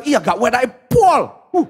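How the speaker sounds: caught-out surprise. Retold or imitated. Man in toilet suddenly realising the pastor heard, startled. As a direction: voice the man spotting the pastor mid-gossip. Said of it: "dia bilang".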